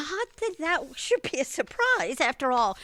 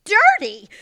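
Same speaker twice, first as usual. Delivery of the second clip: shocked outburst; 7 semitones higher, 11 dB louder, slower than usual. Acted. Mother shocked at dirty floor.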